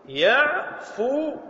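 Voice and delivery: يعفون very slowly with a rising end; drawn out. Shown as a demonstration. Teacher to students, slow questioning يعفون. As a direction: stretch يعفون as a prompt. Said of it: "فيصير ماذا".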